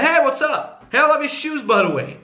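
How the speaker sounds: breezy compliment as if totally normal; permission-giving. Shown as a demonstration. Approaching a girl by day, confidently casual. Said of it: "giving the whole situation permission".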